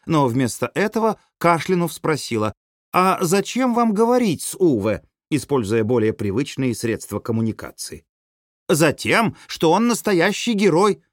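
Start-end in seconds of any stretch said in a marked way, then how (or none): 2.9-5.0 high-pitched question through a door; inquiring call. A narrator's reading. Парване спрашивает через закрытые ворота. 8.7-11.0 high, eager exclamation; exclaiming woman. A narrator's reading. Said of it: "восклицают ворота".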